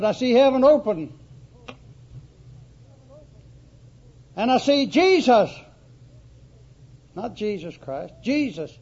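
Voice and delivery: full-voiced preacher's declaration; ringing proclamation. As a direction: cry it out like a man seeing heaven open. Stephen's vision, preached aloud.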